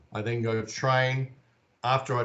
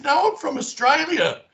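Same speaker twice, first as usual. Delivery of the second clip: much higher, louder mock protest; a high, exasperated denial; 7 dB louder than usual. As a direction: insist you're not him. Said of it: "I said".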